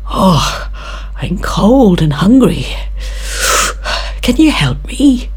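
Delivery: high, loud begging voice; plead, cold and hungry; pleading grasshopper. Acted.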